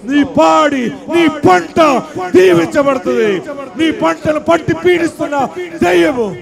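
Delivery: high, loud shouted sermon; fiery revival preacher shouting deliverance; full-force preaching. Acted.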